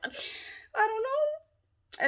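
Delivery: high, drawn-out wail breaking into a cry; mock wailing cry. Retelling her break-in scare to viewers, a mock wailing cry. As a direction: let the words collapse into a whiny sob.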